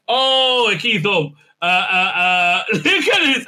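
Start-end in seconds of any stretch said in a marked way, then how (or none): 0.1-1.3 loud, high caricature catchphrase; mock exclamation. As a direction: hoot it as a hammed-up parody.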